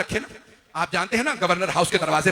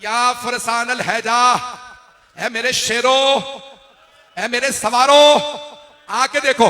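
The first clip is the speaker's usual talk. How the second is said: high, loud, slow rallying cry; calling out; 7 semitones higher, 10 dB louder, slower than usual. A narrator's reading. Imam summons his fallen lions and riders, calling out. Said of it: "मौला ने आवाज दी".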